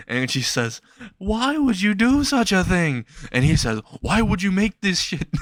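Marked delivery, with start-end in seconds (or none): 1.2-3.0 high-pitched, drawn-out protest; acted victim's appalled cry, pitched way up; hammed-up dismay. Burned cook protests in dismay. 4.0-5.3 high-pitched, indignant comeback; acted exasperation at awful food; mock angry retort. Angry diner retorts to cook.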